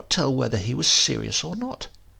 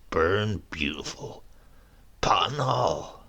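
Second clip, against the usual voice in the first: dreamy regretful; 6 semitones lower, 5 dB quieter, slower than usual. A narrator's reading. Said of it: "in the dreamy, regretful way he kept".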